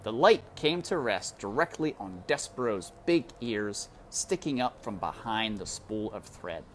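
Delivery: usual speaking voice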